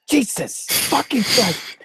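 high, slow exasperated curse; mock exasperation. Real, not acted. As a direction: give up in disbelief. Exasperated outburst.

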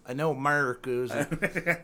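mocking "America is" slurred into "Amurrikuz"; mimicked mispronunciation. Teasing co-host about how "America" came out, mimicked mispronunciation. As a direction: run "America is" together as "Amurrikuz", half laughing. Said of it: "sounded like that".

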